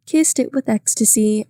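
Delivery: plain reading voice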